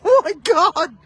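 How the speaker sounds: high, loud laughing oh my God; laughing in shock. Real, not acted. Commentator cracking up at the dropped catch, laughing shock. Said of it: "I'm in shock".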